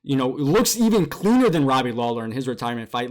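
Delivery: ordinary talk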